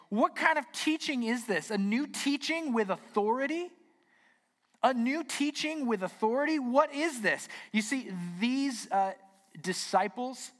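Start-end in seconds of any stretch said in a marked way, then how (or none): 0.1-7.5 high-pitched, acted astonishment; amazed. Synagogue crowd reacting to a new teaching, acting amazed. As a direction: question it in wonder, pitch lifted right up. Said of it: "the people talking are amazed and they say".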